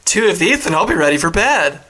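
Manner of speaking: loud put-on lightweight voice; Flitwick. Co-host playing Flitwick over his cherry soda, loud comic voice. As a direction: play a giddy little drinker, hammed up. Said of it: "Flitwick's a lightweight".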